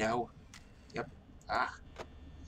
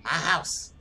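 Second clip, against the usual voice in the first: loud firm; 3 semitones higher, 6 dB louder than usual. Real, not acted. Loud claim to animatronic.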